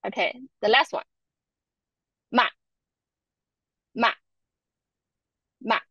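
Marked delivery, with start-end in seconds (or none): 2.3-5.8 MA very short; neutral tone. Shown as a demonstration. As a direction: clip MA off quickly in neutral tone. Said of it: "this one is the neutral tone".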